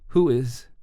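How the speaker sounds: careful, high female-character voice; careful question. A narrator's reading. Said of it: "choosing her words carefully".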